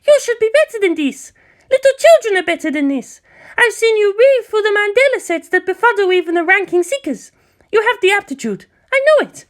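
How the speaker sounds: high, pressing woman's voice demanding an answer; demanding young woman. Acted. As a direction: push hard for the truth in a high voice.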